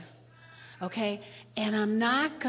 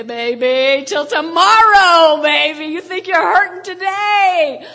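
louder, slower sing-song taunting; mock-menacing sing-song about pain to come; gleeful taunt; 3 semitones higher, 12 dB louder, slower than usual. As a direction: crow it at him with glee. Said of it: "And I'm thinking".

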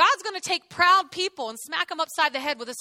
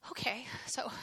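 whisper; 2 semitones lower, 14 dB quieter than usual. Real, not acted. Aside to the congregation.

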